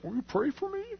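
small, high, soft pleading voice; terrified plea. Retold or imitated. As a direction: imitate a frightened man begging softly. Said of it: "he was terrified".

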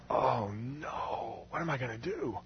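soft, drawn-out "oh no"; quiet dismay. Retold or imitated. Helper finding the doctor's office closed, quiet dismay. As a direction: let it sink, hushed and dismayed.

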